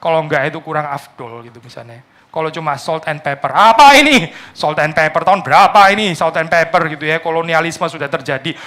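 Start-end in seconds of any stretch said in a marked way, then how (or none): 3.5-6.1 sharp high scoff at bland food, loud; mock indignation. Preacher mocking a bland European dish, mock indignant. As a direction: scoff at the food, then sneer at it. Preacher mocks salt and pepper.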